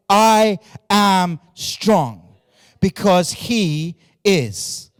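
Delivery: a triumphant, slow proclamation; slow emphatic declaration. Acted. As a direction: land the promise slowly, word by word. Climax of the sermon point.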